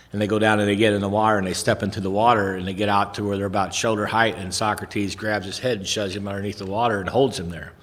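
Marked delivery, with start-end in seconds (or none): none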